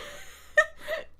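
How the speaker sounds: very high squealing laugh; high cackle. Real, not acted. Teasing a friend about the race, high cackle.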